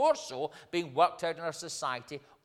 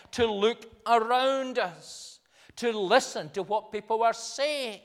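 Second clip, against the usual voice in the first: raised, measured pulpit exhortation; insistent preaching; 6 semitones higher, 6 dB louder than usual. Acted. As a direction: press each point home to the congregation.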